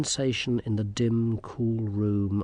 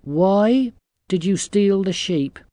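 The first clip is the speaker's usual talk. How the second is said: slow, higher, louder accusation; stern girl; 8 semitones higher, 7 dB louder than usual. A narrator's reading. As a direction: question them slowly, like a cross girl. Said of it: "She glared at them".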